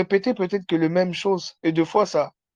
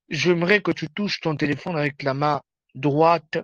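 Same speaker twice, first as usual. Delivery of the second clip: ordinary talk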